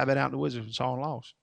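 low, soft, rapid Kentucky mumble imitation; Kentucky drawl, fast and soft. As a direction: imitate a Kentuckian rushing his words softly. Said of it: "he talked fast and soft".

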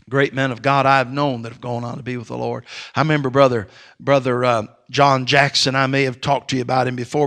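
ordinary talk, nothing marked

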